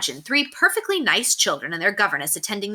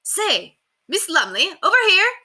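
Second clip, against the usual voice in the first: 1 calling out; 12 semitones higher, 4 dB louder than usual. A narrator's reading. Child calling across the street.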